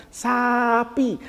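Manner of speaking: "sapi" stretched into two clear syllables; teacher's dictation. Retold or imitated. Teacher showing a cow picture, "sapi" drawn out clearly. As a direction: sound out "sa-pi" for a child. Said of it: "dibawa gambar sapi".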